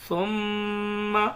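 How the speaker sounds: slow, loud, drawn-out tajweed recitation; measured recitation of verses; Quran recitation. Shown as a demonstration. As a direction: chant each word slowly with long pauses. Recitation continues.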